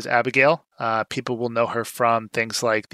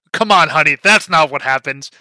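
louder, higher, patronizing put-down; condescending mock; 3 semitones higher, 7 dB louder than usual. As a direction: talk down to her like a know-it-all. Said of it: "So they're like".

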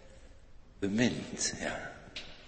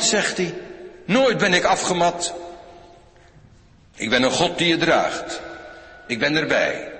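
loud, high, proclaiming the voice of God; full-force preaching; 5 semitones higher, 5 dB louder than usual. Acted. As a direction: thunder it out like a prophet. Preekt Gods woorden met volle kracht. (luid en hoog verkondigend, als de stem van God)

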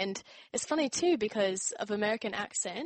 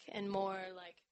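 ordinary talk, nothing marked

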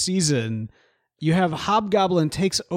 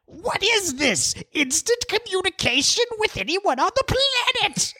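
gravelly sly hobgoblin. Retold or imitated. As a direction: squeal it like an amazed Hobgoblin villain. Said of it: "Mark Hamill, Hobgoblin voice".

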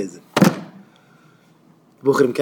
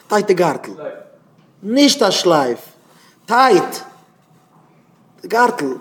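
high, falling single-word orders with long pauses; strict commands; 5 semitones higher, slower than usual. Acted. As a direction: order each point separately and emphatically. Acts out stiff, tight posture commands. (שטרענגע, הויכע באפעלן)

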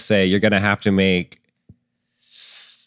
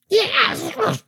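excited cheer. Acted. GM voicing crowd reaction.

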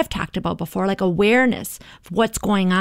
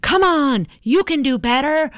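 higher, louder drill-sergeant push; 6 semitones higher, 3 dB louder than usual. Retold or imitated. Harsh inner voice scolding oneself, mock drill-sergeant shout. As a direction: bark it like a tough inner critic. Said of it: "like a drill sergeant".

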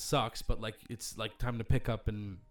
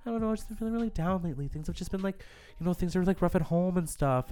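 higher, fast, put-upon poor-me voice; mock attention seeker; 6 semitones higher than usual. Adult fishing for sympathy at work, mocking poor-me voice. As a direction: play the victim fishing for sympathy. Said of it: "And you're just like".

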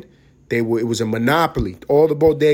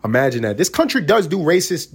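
ordinary talk, nothing marked

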